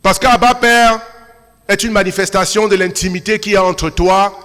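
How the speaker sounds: loud, ringing sermon delivery; full-force preaching. Acted. Pastor exhorting the congregation, in full preaching voice. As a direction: preach it out to the whole church.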